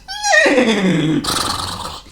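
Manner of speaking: high whinnying neigh; whinny like an old horse; high loud horse whinny. A narrator's reading. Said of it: "Whinnied the old farm horse".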